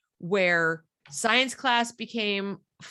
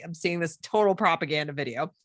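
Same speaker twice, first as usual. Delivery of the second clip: usual speaking voice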